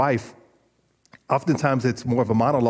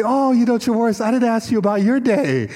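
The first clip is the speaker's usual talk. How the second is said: high, louder imitation of wife; imitating wife; 10 semitones higher, 4 dB louder than usual. Wife finally asking husband about his day, imitated high and eager. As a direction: voice her pitched up, suddenly remembering to ask. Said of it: "and then she say".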